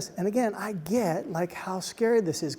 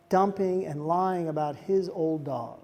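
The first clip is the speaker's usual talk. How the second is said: ordinary delivery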